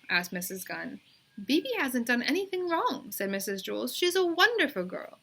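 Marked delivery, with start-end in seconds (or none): 1.4-5.2 higher, bright praise; teacher's high praising voice. A narrator's reading. Teacher praising Bebe to her mother, higher. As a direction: speak up for the girl eagerly.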